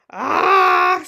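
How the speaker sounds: very loud booming cry; outraged shout. A narrator's reading. Stoic walking in on the mess, booming. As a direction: bellow in outrage.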